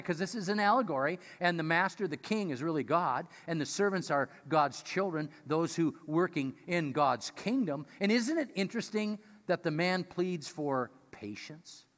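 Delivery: ordinary delivery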